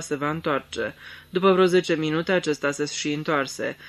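usual speaking voice

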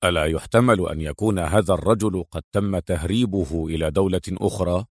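low, soft hero's voice asking intently; understated low male character, attentive; interested question. A narrator's reading. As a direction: ask with quiet, low-pitched interest. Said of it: "وسأل باهتمام".

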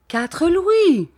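high, loud whining plea; moaning. Acted. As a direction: moan the offer desperately. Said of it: "gémit monsieur Mathias". (gémissement plaintif)

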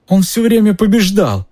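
very high, tearful wail; sobbing. A narrator's reading. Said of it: "всхлипнул Петтигрю".

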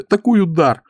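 high-pitched dismayed exclamation; woeful high voice; anguished. A narrator's reading. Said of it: "с тоской сказала Зоя".

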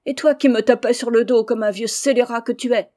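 loud shrill scolding of her husband; angry shouting. Acted. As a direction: yell back at him, fed up. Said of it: "criant à qui mieux mieux". (femme qui crie contre son mari)

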